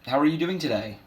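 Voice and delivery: lightly voiced chirpy counter girl; counter girl greeting. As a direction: imitate a perky cashier's hello. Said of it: "girl behind the counter said".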